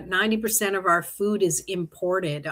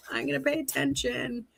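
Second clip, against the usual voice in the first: softer, murmured repeat to herself; quiet echo; 4 semitones higher, 8 dB quieter than usual. Retold or imitated. Tarot reader repeating her own vow under her breath, quiet. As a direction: let it fall away, half to herself. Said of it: "So I'm like".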